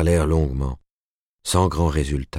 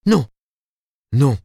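sudden loud, high protest; two sharp, separate exclamations; crying out; 9 semitones higher, 6 dB louder than usual. A narrator's reading. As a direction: cry out in refusal. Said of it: "s'écria Ruth en se redressant".

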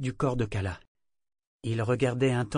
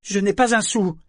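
loud, much higher furious retort; Yémin defying the broker; 10 semitones higher, 8 dB louder than usual. A narrator's reading. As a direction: spit it back in rage. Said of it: "d'un ton furieux".

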